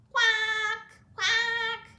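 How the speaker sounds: duck quack. Shown as a demonstration. Showing kids a duck's sound, high quacks. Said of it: "Let's make their sounds".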